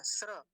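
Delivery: स्र as s straight into ra; cluster demo. Shown as a demonstration. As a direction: hiss the s, then ra in स्र. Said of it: "इन दोनों को मिलके बनता है".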